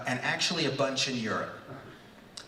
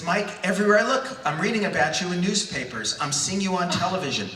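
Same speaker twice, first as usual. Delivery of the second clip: higher, enthusiastic gushing; Stan Lee, exuberant; 5 semitones higher, 4 dB louder, faster than usual. Acted. Comics legend praising a young teacher by phone, exuberant. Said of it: "this exuberant male voice".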